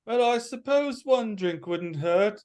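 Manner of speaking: high-pitched British guy voice; British character. Acted. British guy accepts the offer of a drink, in a high British accent. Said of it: "the British guy is like".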